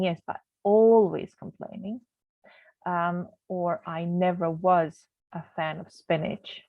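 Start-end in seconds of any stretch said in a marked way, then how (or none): 2.9-6.6 "was" stressed with a rising tune. Shown as a demonstration. Teacher demonstrating emphatic "was" to the class, stressed. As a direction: punch the word "was" in "I never was a fan". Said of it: "So I'm emphasizing the fact that I was".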